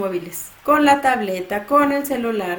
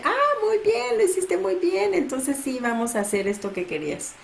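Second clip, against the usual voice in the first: much higher, bright sing-song praise; praising parent; 8 semitones higher than usual. Retold or imitated. Parent praising child for calming down, high delighted praise. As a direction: gush over a kid who did well.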